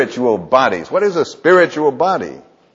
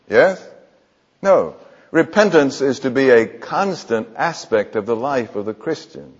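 ordinary talk, nothing marked

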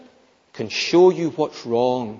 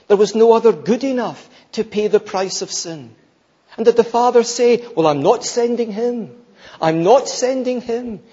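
higher, louder pulpit appeal; impassioned preaching; 9 semitones higher, 6 dB louder than usual. Acted. Preacher marvelling at the Father's giving, impassioned and high. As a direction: press the point in a raised voice.

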